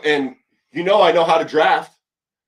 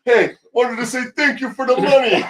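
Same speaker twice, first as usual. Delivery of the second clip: high laughing mock player voice; silly put-on voice; 9 semitones higher than usual. Mocking a player thanking the owner, high silly voice. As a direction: ham up a giddy thank-you.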